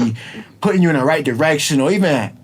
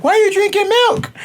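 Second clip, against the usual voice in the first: put-on high-pitched child voice; nosy kids. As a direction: squeal the question like curious little kids. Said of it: "And they'd be like".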